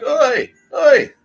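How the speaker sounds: loud, high, drawn-out calling out; Bill Burr impression. Soccer jerks shouting for their ball, mock Bill Burr holler. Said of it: "They all sound like Bill Burr".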